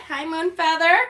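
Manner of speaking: normal talking voice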